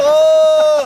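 loud high cry of pain; pain scream. Real, not acted. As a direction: yell out from a sudden hit. Hit on the feet.

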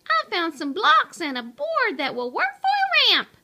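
fast, high, loud hollering; high-pitched rapid shout; excited holler. A narrator's reading. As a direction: holler the news across the room. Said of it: "hollered Pipsqueak".

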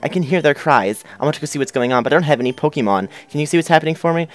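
put-on high-pitched timid kid voice; little kid. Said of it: "and the kid's like".

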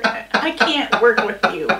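laughing through the words. Real, not acted. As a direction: crack up mid-sentence. Laughing fit while filming.

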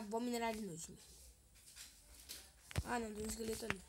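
ordinary talk, nothing marked

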